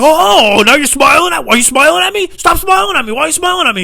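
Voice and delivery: high, loud mock outrage; angry screaming. Employee accusing the customer of smiling back, screaming outrage. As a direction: re-enact screaming while smiling. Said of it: "screaming at each other".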